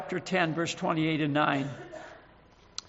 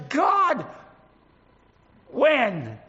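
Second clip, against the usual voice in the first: raised plea flung upward; crying out to God; 6 semitones higher, 3 dB louder than usual. Acted. Preacher pleading aloud with God over the world's lies. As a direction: call out to heaven, pleading.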